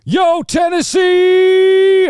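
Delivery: huge high yell, long drawn out; hype-man shout. Shown as a demonstration. Showing how to greet a Tennessee crowd, hype shout. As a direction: bellow a stretched greeting to an arena. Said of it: "shout into the microphone".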